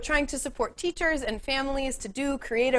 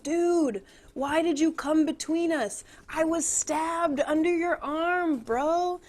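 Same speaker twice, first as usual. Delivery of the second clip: casual dying Mercutio; 5 semitones higher, 3 dB quieter, slower than usual. Retold or imitated. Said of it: "he's like".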